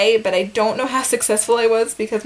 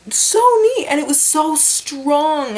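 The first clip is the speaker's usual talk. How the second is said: high, slow, amazed; awed; 6 semitones higher, slower than usual. Real, not acted. Awed at the pulsing cord.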